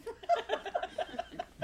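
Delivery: a high, unvoiced burst of laughter; laughing at the tiny cars joke; breathy laugh. Real, not acted. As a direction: laugh silently at your own joke.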